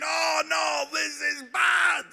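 high, loud alarmed cry, slowing on bad; dread. Preacher reliving dread among reckless boys, loud alarmed wail. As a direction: wail it like doom is coming. Retold dread before canyoning jump.

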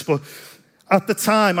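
normal talking voice

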